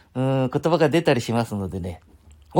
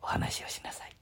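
whisper; 13 dB quieter than usual. Retold or imitated. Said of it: "話聞いてると".

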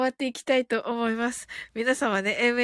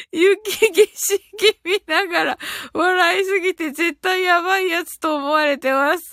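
after laughing hard; 4 semitones higher, 4 dB louder than usual. Real, not acted. Reading comment between hard laughs.

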